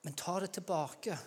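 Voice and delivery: normal talking voice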